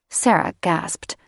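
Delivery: ordinary delivery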